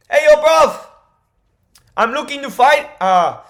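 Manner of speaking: loud, high mock London accent; hammed-up London geezer calling out a fight; Leon Edwards. Vlogger imitating a fighter demanding a fight, in a mock London accent. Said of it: "I'm not good at accents".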